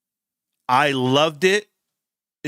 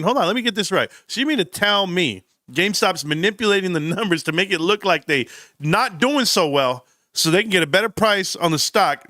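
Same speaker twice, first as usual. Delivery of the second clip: normal talking voice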